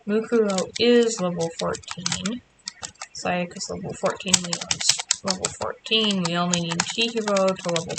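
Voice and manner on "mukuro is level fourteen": normal talking voice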